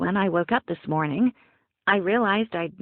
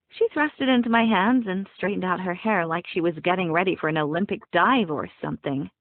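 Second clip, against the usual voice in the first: usual speaking voice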